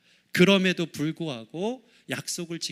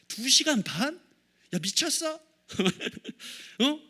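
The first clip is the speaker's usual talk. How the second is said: higher-pitched indignant dad; mock scolding; 5 semitones higher than usual. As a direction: act out telling the kids off. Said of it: "막 야단을 치는 거예요". (야단치는 아빠 흉내)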